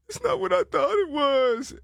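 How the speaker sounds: high, squeaky imitation of a strung-out woman; mock crackhead woman. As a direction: pitch it way up like the spaced-out girl. Quoting strung-out girl.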